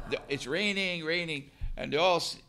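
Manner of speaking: ordinary delivery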